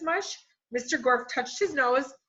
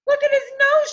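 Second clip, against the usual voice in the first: much higher, loud cry; shouted; 10 dB louder than usual. A narrator's reading. Eric Bacon shouts at the class about the nose. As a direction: yell it out to the whole room. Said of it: "shouted Eric Bacon".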